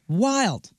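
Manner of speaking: ordinary talk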